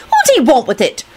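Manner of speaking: high, irritated question; irritable. A narrator's reading. Rosie challenging Kenny's accusation, irritably. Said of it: "Rosie replied irritably".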